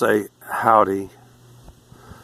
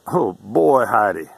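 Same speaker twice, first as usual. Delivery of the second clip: Texan exclamation. Shown as a demonstration. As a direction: exclaim it at a discovery. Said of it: "if it's an exclamation".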